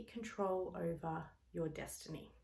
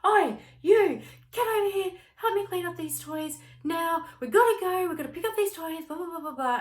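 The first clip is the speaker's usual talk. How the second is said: loud, high, bossy shouting; much higher, louder frazzled parent yelling; yelling at toddler; 11 semitones higher, 11 dB louder than usual. Pretend. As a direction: bark orders at the kid in a rush. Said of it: "because you're yelling at me".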